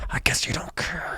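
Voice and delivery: sinister whispered threat; creepy whisper. Acted. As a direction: whisper it menacingly.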